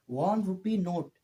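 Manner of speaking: वन rupee नोट said slowly, each word drawn out; slow, spelled-out reading. Shown as a demonstration. Teacher reads वन rupee नोट slowly to class, slow. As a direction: stretch out वन rupee नोट slowly so students hear the sounds. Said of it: "यहाँ पे लिखने से क्या होता है आपको".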